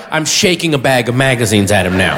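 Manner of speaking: ordinary talk, nothing marked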